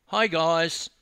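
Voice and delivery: loud, high cheery hail; breezy greeting. A narrator's reading. Dawn bounding out to greet the youths, breezy greeting. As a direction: call out to them boldly.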